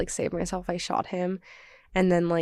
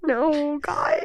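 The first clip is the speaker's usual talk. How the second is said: squeaky protest; 7 semitones higher than usual. Real, not acted. Embarrassed protest to friends.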